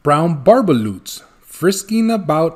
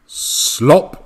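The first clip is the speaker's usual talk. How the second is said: slop sound; 2 semitones higher, 4 dB louder than usual. Acted. Sound of the phone dropping.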